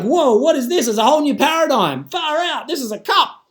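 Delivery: wide-eyed, excited realization; acted amazement. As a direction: marvel as if discovering something wholly new. Acts amazed discovery of cup.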